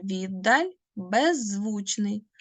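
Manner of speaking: «віддаль», «беззвучний» said slowly and clearly; slow model words. Shown as a demonstration. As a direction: show the doubled sounds, slowly. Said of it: "Подивіться". (повільно, чітко, з подовженими звуками)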